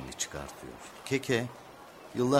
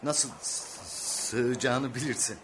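higher-pitched, catching on a consonant; stammering; 5 semitones higher, slower than usual. Acted. Father urges his son into the gap, stammering. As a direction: stumble mid-phrase, then push the word out.

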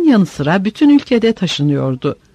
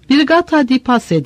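understated call back, a little higher; mild narrated shout; 6 semitones higher than usual. A narrator's reading. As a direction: answer the officer with a mild yell. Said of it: "diye bağırdı biri".

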